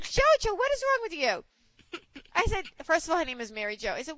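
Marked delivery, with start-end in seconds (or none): 0.0-1.4 high-pitched mock scolding; exasperated scolding. Friend retelling how she set JoJo straight, exasperated scolding.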